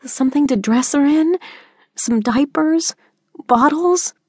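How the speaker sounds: put-on pleading teen correcting her mother; teen daughter, protesting. A narrator's reading. Daughter Jordan voiced by narrator.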